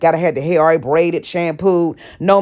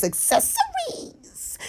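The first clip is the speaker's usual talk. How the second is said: squeaky voice; 10 dB quieter, much slower than usual. Pretend. Mock high aside.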